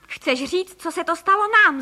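rising to a high, loud finish; exclaiming. A narrator's reading. Husband correcting his wife about their fate, exclaiming. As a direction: build to an indignant call. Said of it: "zvolal pan Frejka".

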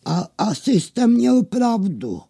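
high, awkward concession; sheepish. Acted. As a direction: admit it with embarrassment. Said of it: "zahuhlal Žblabuňka rozpačitě".

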